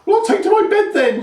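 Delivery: high, loud crone voice; huffing old crone. A narrator's reading. Grandma huffing off to her straw bed. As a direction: say it in a sulky huff. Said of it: "she huffed".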